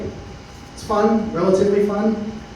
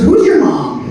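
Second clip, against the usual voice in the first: much higher, louder alarmed question; alarmed officer; 4 dB louder, slower than usual. Retold or imitated. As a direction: demand it like a startled cop. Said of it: "He goes".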